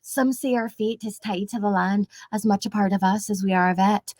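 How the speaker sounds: measured Scottish storytelling voice; Scottish-accented film heroine narration. Acted. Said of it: "like, a Scottish accent".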